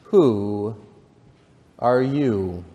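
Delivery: drawn-out "who", then a pause; slow, sleepy caterpillar voice; languid, sleepy caterpillar. A narrator's reading. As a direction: draw each word out languidly. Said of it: "addressed her in a languid, sleepy voice".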